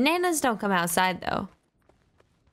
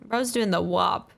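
ordinary delivery

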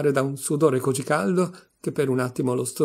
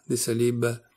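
ordinary delivery

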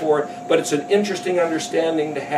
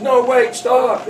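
higher-pitched mock plea to stop; urgent protest; 6 semitones higher, slower than usual. Moses to the complaining crowd, urgent protest. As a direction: act out a leader trying to halt complaints.

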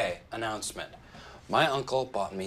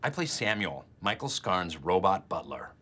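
usual speaking voice

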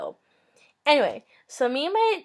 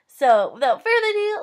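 bright jump up into the story; excited announcement; 11 semitones higher, 5 dB louder than usual. Real, not acted. Vlogger hyping viewers up for the funny story. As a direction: launch it with a lift.

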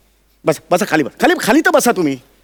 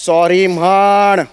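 very slow, low, stern command; menacingly stretched parental order; 6 semitones lower, much slower than usual. Acted. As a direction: draw out the order to apologise, low. Father repeats the order sternly.

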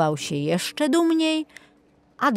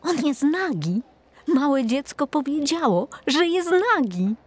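much higher, excited telling; townsman passing it on; 9 semitones higher than usual. Acted. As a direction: spread the news to a neighbour. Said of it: "w tłumie jeden zaczął szeptem powtarzać". (podekscytowany mieszczanin, dużo wyżej)

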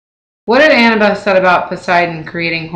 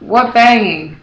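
yelled; 4 semitones higher, 4 dB louder, slower than usual. A narrator's reading. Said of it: "Outside, Eddie yelled".

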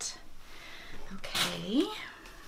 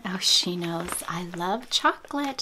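ordinary talk

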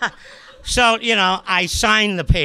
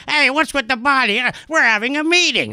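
lightly voiced, higher-pitched complaint; complaining diners; 7 semitones higher than usual. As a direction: grumble as an interrupted tough customer, pitched up. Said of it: "And they're going".

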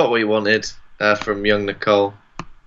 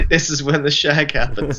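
mock quoting; 3 semitones higher than usual. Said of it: "Did you say".

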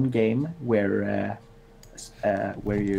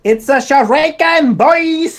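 very high, loud cheer; excited shout; 10 dB louder than usual. Pretend. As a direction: yell it to the whole team. Echoes the shout louder.

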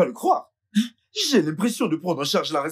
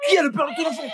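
high, shouted demand for the truth; mother confronting daughter sharply; angry demand; 12 semitones higher, much faster than usual. Acted. Mother demands the father's name, shouting.

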